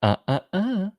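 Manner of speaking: high wagging uh uh uh; mock warning from the movie, sung high; Jurassic Park quote. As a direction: scold like the Jurassic Park computer. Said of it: "like Jurassic Park".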